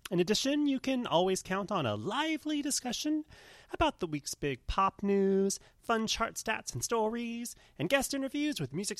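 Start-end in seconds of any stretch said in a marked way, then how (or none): none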